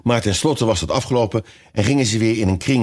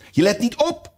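high-pitched stern scolding. A narrator's reading. The mouse scolds Alice for not paying attention, stern. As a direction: rap out a sharp reproof in a high voice. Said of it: "zei de muis streng tot Alice".

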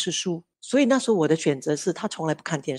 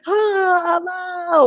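imitating crying child; 11 semitones higher, slower than usual. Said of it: "他就哭嘛".

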